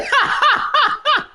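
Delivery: sudden high burst of laughter; cracking up high at her own line. Real, not acted. Laughing at her own joke, a high burst of laughter.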